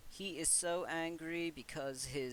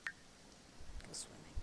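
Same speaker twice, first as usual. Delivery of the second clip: very slow, breathless whisper; whispered slow command to the stubborn boy; whispered order; 14 dB quieter, much slower than usual. Acted. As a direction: hiss the command under your breath. Player ordering Sim to swim.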